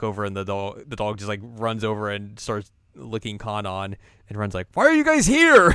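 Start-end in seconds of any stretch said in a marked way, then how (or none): 4.7-5.7 very high, loud startled cry; surprised shout. Retold or imitated. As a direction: shriek in surprise.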